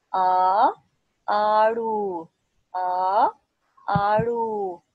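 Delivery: loud, stretched "आ" before each word; loud recitation drill. Shown as a demonstration. Teacher leading a loud drill of "आ" words. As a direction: call out each word for children to repeat. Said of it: "मेरे साथ पढ़ें".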